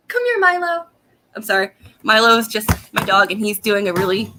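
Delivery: ordinary delivery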